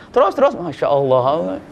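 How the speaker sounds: "Terus, terus, masya Allah" slow and drawn out, murmured like a lullaby; "Terus, terus" sung out slowly, half asleep; drowsy sing-song reply. Acted. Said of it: "kayak nyanyi saja". ("Terus, terus" diucapkan pelan dan panjang seperti bernyanyi)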